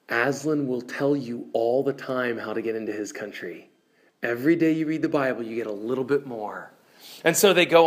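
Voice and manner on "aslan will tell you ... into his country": ordinary delivery, nothing marked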